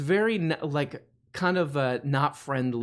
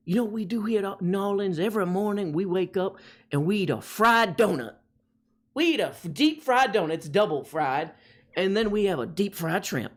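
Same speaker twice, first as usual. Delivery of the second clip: put-on higher, lilting Cajun accent; 5 semitones higher than usual. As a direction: play a proud New Orleans local in Cajun. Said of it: "This is my Cajun accent".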